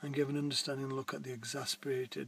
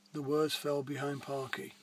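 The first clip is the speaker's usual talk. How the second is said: plain reading voice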